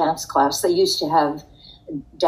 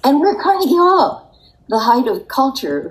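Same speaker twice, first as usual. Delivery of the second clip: excited; 8 semitones higher, 5 dB louder than usual. Real, not acted. Excited memory of glamorous meeting.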